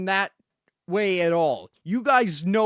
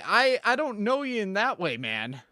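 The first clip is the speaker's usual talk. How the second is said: ordinary talk, nothing marked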